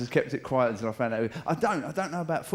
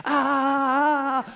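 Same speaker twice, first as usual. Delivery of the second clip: long, high, loud battle yell; sustained mock hooligan roar; charging war cry; 10 semitones higher, 6 dB louder than usual. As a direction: yell like a mob breaking into a charge. Hooligans charge at each other.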